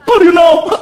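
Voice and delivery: mock mom demanding. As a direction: challenge him like a suspicious wife.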